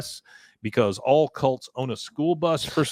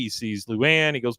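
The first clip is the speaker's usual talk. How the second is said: usual speaking voice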